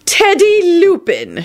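slow, high, drawn-out name; stern intoning. A narrator's reading. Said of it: "she intoned sternly".

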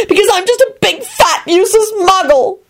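high-pitched tearful bawl; acted weepy outburst; Elizabeth, bawling. A narrator's reading. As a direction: wail it like someone in a tantrum. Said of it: "she bawled as she stomped".

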